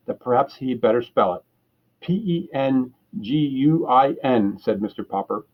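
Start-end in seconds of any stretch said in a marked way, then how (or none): 2.0-4.6 slow, letter by letter spelling. Acted. Caller spelling for a confused clerk, slow and deliberate. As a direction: spell each letter slowly down the line. Spelling slowly over the phone.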